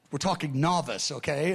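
normal talking voice

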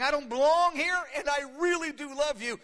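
high, loud, acted plea; mock tearful cry. Preacher voicing a crying believer in wanderings, loud plea. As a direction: cry it out to God, hammed up. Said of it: "And you're crying".

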